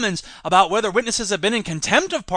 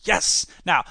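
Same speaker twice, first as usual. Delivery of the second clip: ordinary delivery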